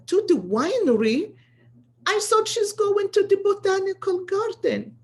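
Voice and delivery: loud, high indignant outburst; incredulous angry cry of disbelief; inner outrage. Retold or imitated. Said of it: "I was so pissed off".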